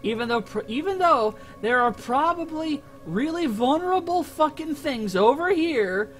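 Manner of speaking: ordinary talk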